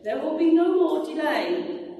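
ringing angelic announcement; angel's proclamation. Acted. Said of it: "The angel said".

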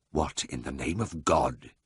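low, soft and menacing; ominous quiet. A narrator's reading. Siegfried to his brother, ominously quiet. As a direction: hold the fury under a quiet voice. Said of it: "he inquired with ominous quiet".

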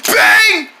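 loud, high exclamation; shouted bang. Acted. Someone going down in the theme, shouted. Sound word in theme chant.

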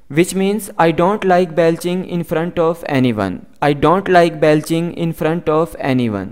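very slow, careful English for learners; slow model sentence. Shown as a demonstration. Teacher reading the example slowly to students. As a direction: spell each word out slowly for the class. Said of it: "teacher model sentence".

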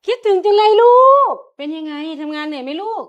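loud, gushing welcome; excited greeting. Acted. Mother gushes loudly over her returning daughter. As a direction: greet her with open arms.

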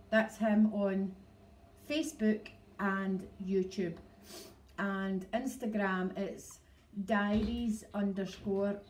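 slow, careful word-by-word delivery; talking slow. Shown as a demonstration. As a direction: spell it out slowly for listeners unused to the accent. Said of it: "That's me actually talking slow".

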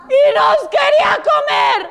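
very high frightened squeal; panicked chick. Acted. Last chick saying it wanted to eat them, panicked. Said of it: "Se asustó y empezó a hiperventilar".

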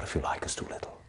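rueful trailing whisper. Acted. Psychiatrist admitting his weakness, whispered. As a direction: drop to a whisper for the admission. Private musing monologue.

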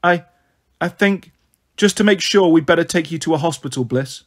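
ordinary delivery

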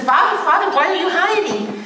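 baffled, half-laughing to find him there; surprised calling. Retold or imitated. Young man finding the priest hiding under the piano, high and puzzled.

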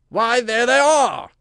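high, loud, drawn-out triumph; exultant king's cry; triumphant. A narrator's reading. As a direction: crow it as the proof is found. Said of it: "said the king triumphantly".

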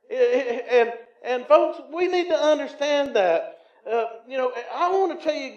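normal talking voice

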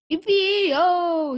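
high, loud cowboy whoop; cowboy shout. A narrator's reading. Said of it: "shouted Leroy Ninker".

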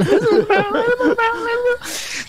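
high squeaky laughing call; laughing falsetto. Real, not acted. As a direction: break into laughter, pitch shooting up. Summoning ghost, cracking up.

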